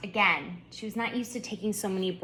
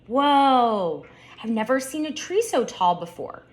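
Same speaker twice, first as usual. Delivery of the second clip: amazed; 5 semitones higher, 5 dB louder than usual. A narrator's reading. Turtle amazed by tall tree.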